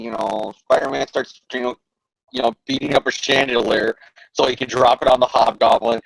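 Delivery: ordinary talk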